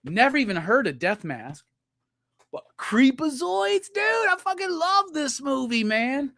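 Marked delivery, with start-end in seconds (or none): 2.5-6.3 high-pitched fan excitement; delighted gushing. Real, not acted. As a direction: squeal with love for the movie. Spots a movie he loves.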